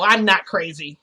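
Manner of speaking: loud, irritated retort; mildly angry, forceful denial; kind of mad. Retold or imitated. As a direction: snap back, stung by the label. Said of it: "starting to get, like, kind of mad".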